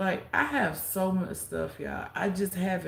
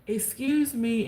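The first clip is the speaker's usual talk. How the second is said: calling for attention; 6 semitones higher, 6 dB louder, slower than usual. Retold or imitated. Stood up before whole church.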